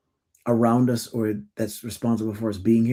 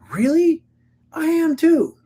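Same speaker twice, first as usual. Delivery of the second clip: surprised delight; 4 dB louder, slower than usual. Retold or imitated. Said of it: "their response was".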